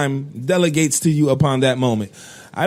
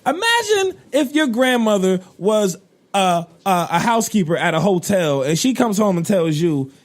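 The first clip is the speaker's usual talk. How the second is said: ordinary delivery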